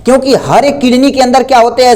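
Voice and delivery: usual speaking voice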